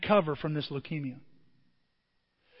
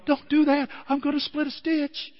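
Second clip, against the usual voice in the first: pleading patient; 8 semitones higher than usual. Retold or imitated. Laughing patient begging him stop.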